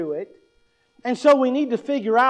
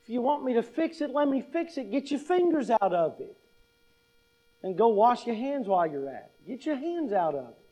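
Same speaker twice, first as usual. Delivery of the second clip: quiet warning; 6 dB quieter than usual. Retold or imitated. Adult to a child with grabby hands, quiet firm warning. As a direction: hush the kid away from the job. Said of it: "What do you say to it?".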